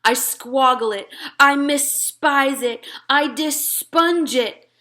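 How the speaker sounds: loud, slow, disgusted growl of words; disgusted giant. Acted. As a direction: spit each made-up word out. Disgust at the vegetable.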